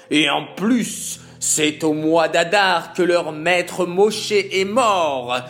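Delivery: gloating villain. Acted. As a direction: savour the evil plan. Haman gloating over date. (jubilation cruelle du méchant, plus forte)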